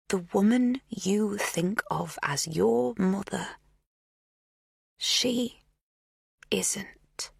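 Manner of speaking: slow whisper, every syllable set apart; each syllable defined. A narrator's reading. Magda revealing the truth about the mother, slow and deliberate. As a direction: space out each word deliberately. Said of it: "Each syllable is so clearly defined".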